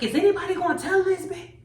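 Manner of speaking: lady yelling out. Retold or imitated. A lady in the crowd heckling the comic, mock yell. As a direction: toss it out like a fed-up heckler. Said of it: "one lady I hear yell out".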